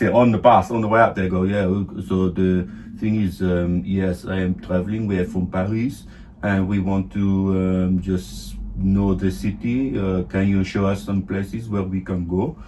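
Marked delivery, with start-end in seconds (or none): 1.4-12.6 put-on low, soft, slow French tourist accent; hesitant mock-French tourist English, low and measured. Practising a tourist act on the bus, French accent. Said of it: "Or French accent".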